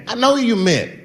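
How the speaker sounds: usual speaking voice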